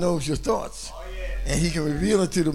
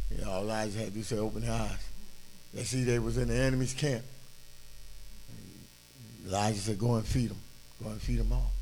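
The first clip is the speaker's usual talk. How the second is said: hushed; 9 semitones lower, 10 dB quieter, faster than usual. Acted. Very soft storytelling.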